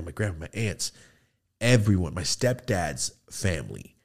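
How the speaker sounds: ordinary delivery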